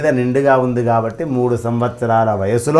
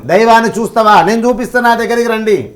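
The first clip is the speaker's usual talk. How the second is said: higher, bolder challenge to the doubters; preaching challenge; 6 semitones higher than usual. Acted. Speaker challenges unbelievers, in a raised preaching voice. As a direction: dare them to come and see.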